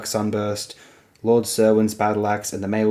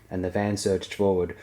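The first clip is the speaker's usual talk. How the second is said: usual speaking voice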